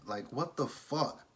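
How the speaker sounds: slow, exasperated what the fuck; irritated disbelief. Real, not acted. Vlogger fed up with the fake recording, slow irritated disbelief. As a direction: drag it out in annoyed disbelief. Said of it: "I was irritated".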